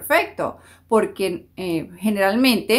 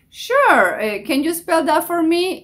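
much higher, bright mock clinic receptionist; 7 semitones higher than usual. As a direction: chirp it like a front desk. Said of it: "Voy con Guadalupe". (recepcionista en inglés)

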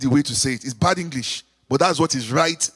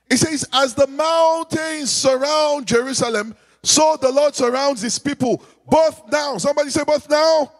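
raised, ringing preacher's call to the church; preaching; 5 semitones higher than usual. Acted. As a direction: preach it out to the congregation, high and strong. Preacher leading the congregation.

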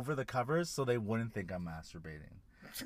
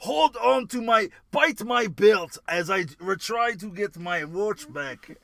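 put-on Russian accent; 5 semitones higher, 6 dB louder, slower than usual. Continuing the Russian bit.

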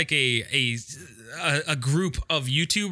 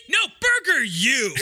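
higher, louder, slow punchline delivery; cheesy commercial announcer; 8 semitones higher, 4 dB louder than usual. Acted. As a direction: sell the slogan like a hammy infomercial. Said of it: "their fucking slogan is".

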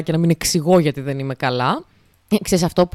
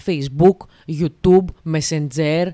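"Facebook" and "Messenger" stressed on the final syllable, slow and a bit louder; stress on the last syllable; 3 semitones lower, 3 dB louder, slower than usual. Retold or imitated. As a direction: mimic older folks stressing the end of each word. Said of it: "όλα στη λίγουσα τα τονίζουν". (τονισμός στη λήγουσα: «Facebook», «YouTube», «Messenger» αργά)

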